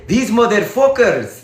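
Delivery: drawn-out mock Colombian accent; exaggerated Latin American accent, stretched out; imitated Colombian accent. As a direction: put on a slow Spanish-speaker accent. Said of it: "they might say it like that".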